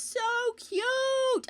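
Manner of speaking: high, slow, cooing voice over something adorable; cutesy coo. Real, not acted. Streamer coos to chat about her cute battery pack, high and slow.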